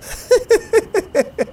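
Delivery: very high-pitched cackle; mocking witch cackle. A narrator's reading. Atla laughs before speaking. (risata acuta e beffarda da strega)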